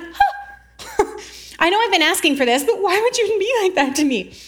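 high, hammed-up gasp and whiny protest; mock offended. Us reacting to God's shout, mock offended. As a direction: play shocked and wounded, pitched high.

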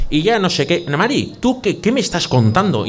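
a high comic Basque farmer voice; Basque sketch voice. Retold or imitated. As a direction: play the rural Basque farmer as in the sketch show. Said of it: "como esto es de Vaya Semanita".

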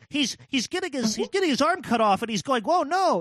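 high-pitched whining mimicry of upset players; high, exasperated mock griping; mock indignant complaint. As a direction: imitate an outraged fan protesting. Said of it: "people getting mad about those moments".

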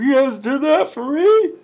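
a high, gushing impression of a flattered onlooker; mock flattered voice. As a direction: play a touched fan in a squeal. Voicing a flattered viewer.

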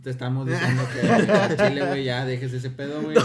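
laughing through a mock-serious lecture. Real, not acted. Friend lecturing about the singer while laughing at himself. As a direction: lecture him but keep cracking up.

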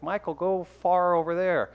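put-on St. Louis accent, lightly done. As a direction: say "far" the St. Louis way. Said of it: "the Saint Louis accent".

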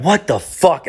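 high-pitched startled exclamation; re-enacted shock; alarmed disbelief. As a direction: retell it as a startled reaction. Alarmed reaction to girlfriend collapsing.

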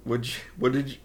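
ordinary delivery

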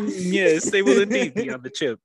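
mock pompous gentleman. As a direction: answer like a pompous aristocrat. Mock stately reply.

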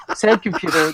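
ordinary talk

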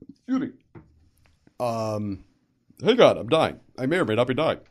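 choking after a sip of iced tea; coughing, drink down the wrong pipe; swallowed wrong way. Real, not acted. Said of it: "went down the wrong pipe".

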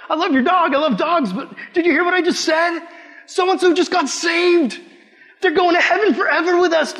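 high, loud, insistent near-yell; exasperated shout. Retold or imitated. Pleading with a friend who ignores the news, shouting. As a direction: shout it to make them finally hear.

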